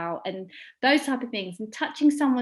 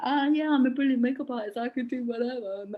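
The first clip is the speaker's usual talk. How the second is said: cocky attitude. Retold or imitated. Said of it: "the misconception ... is just like".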